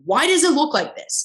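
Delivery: mock the star's complaint; a hint of a snooty actress; demanding diva. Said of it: "She was like".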